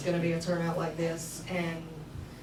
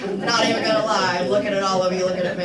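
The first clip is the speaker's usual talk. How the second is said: louder and rushed, caught off guard; flustered nervous outburst; 9 dB louder, faster than usual. Real, not acted. Speaker at the podium admits she went blank, loud flustered rush. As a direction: blurt it out fast, flustered by all the eyes.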